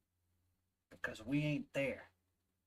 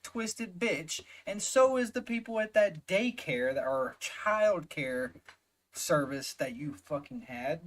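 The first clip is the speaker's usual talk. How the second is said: furious outburst; 4 semitones higher, 4 dB louder than usual. Real, not acted. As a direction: spit it out in real outrage. Yelling insults at the mother.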